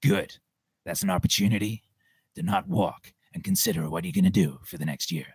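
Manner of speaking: hardcore motivator, forceful and dropping low; Jocko Willink. Retold or imitated. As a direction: imitate a stern military-style mentor. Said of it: "shouts out to Jocko Willink".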